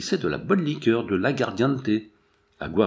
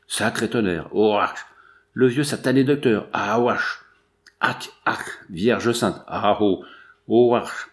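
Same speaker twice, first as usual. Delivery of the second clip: hammed-up oaths broken by gagging; cursing while retching; slower than usual. A narrator's reading. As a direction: curse between acted heaves and spits. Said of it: "crachant tant qu'ils pouvaient".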